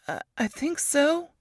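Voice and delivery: a hint of shaken young woman; lightly voiced hesitant reply; Ruth dazed. A narrator's reading. Dazed survivor answering.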